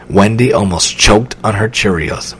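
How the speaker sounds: ordinary delivery, nothing marked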